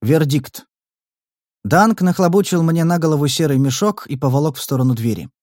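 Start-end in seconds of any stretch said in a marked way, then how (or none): none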